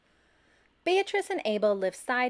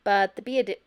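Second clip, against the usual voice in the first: plain reading voice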